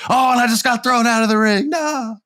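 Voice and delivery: very high, sustained falsetto cry; hammed-up high-pitched lament; mock wail. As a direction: wail as if flung out of the ring. Mock wail as the thrown-out wrestler.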